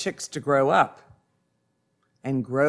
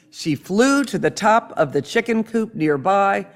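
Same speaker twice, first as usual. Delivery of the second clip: usual speaking voice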